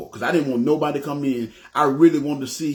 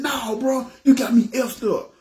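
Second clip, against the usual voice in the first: high, heated mock denial from the robber; imitating a thug getting defensive; 9 semitones higher than usual. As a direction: shoot it up high like he's offended. Robber rejects the accusation.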